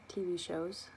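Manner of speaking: normal talking voice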